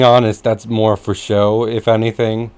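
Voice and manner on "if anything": ordinary talk, nothing marked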